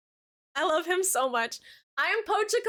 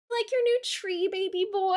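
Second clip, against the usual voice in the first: much higher cooing voice; 6 semitones higher than usual. Real, not acted. Talking to her cat.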